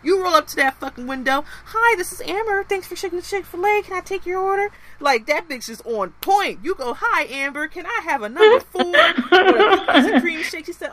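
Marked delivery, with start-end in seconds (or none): 1.7-4.7 high bright service voice, way up; chipper drive-thru worker. Retold or imitated. Mocking the perky worker, high chipper service voice. 7.0-10.7 mock-polite order, loud with a laugh; polite customer. Customer ordering at the window, hammed-up politeness.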